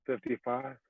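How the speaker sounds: very soft, hesitant question; small, cautious mock guess; tentative guess. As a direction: guess under your breath, unsure. Retold timid guess at score.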